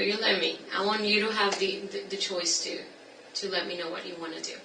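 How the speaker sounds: ordinary talk, nothing marked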